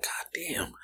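breathy, very soft God damn; cursing the slow customer under the breath, whispered; whispered curse. Retold or imitated. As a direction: mutter it so they can't hear.

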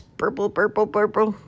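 high, held-pitch bird burble; burbling demonstration. A narrator's reading. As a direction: show him how to burble like a swan. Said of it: "Like this".

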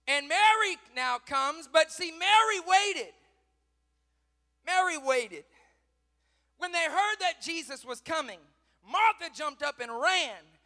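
loud, high, drawn-out sermon cry; full-force preaching. Real, not acted. Preacher telling of Martha and Mary, full-force preaching. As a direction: proclaim the story loudly.

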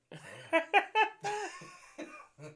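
high, slow words broken by laughter; real laughter swallowing the words; laughing. Real, not acted. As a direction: laugh your way through it. Laughing through retold scene.

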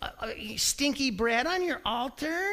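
high, whining protest of innocence; whiny denial. Retold or imitated. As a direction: deny it like a sulky child. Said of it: "He's saying".

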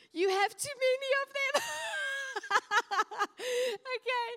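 high laughing burst. Real, not acted. Preacher laughing with the congregation, high and loud. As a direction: crack up mid-joke. Laughing at her own joke.

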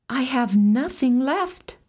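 lightly voiced despairing maiden. A narrator's reading. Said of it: "said she".

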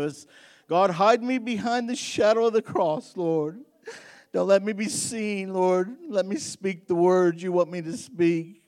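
fervent spoken prayer. Real, not acted. Pastor prays aloud for boldness before preaching, fervent. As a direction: pray earnestly, lifting toward God.

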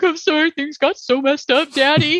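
high, strained imitation of a crying kid; hammed-up whimpering apology, laughing after; mock tearful apology. Kevin comes out of it to his dad, mock tearful. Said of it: "when you come back and he's crying".

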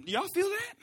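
high, rising nervous question; mock uneasiness. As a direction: ask it high, as if sensing trouble. Disciples first notice the storm.

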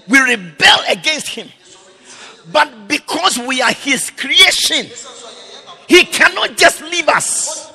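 loud, high, rising preacher's cry; fiery sermon climax; preaching at full force. Real, not acted. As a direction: preach it out, building to a shout.

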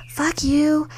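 high-pitched mock tirade; imitated angry accusation. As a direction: act out an aggrieved sister's rant. Drunk sister's accusation, acted out.

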